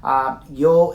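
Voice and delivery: ordinary talk, nothing marked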